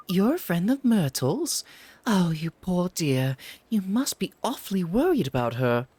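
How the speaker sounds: put-on high, chatty old lady voice; fussing neighbour, sweet and nosy; gossipy old woman.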